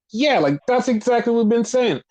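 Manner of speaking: vindicated exclaiming. Retold or imitated. Acts out own vindicated reaction.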